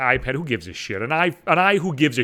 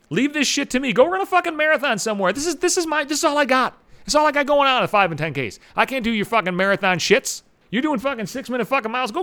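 mock furious rant; 6 semitones higher than usual. Telling marathon runners to leave the five Ks to him, high and furious. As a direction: chase the fast runners off his turf.

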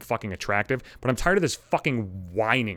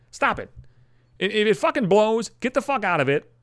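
ordinary delivery

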